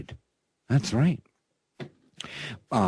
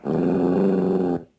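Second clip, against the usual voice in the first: talking to a pet; 4 semitones lower than usual. Real, not acted. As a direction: coo at the dog like a proud owner. Host talks to his dog guest.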